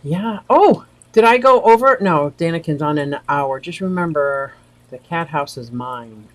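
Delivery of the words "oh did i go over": ordinary talk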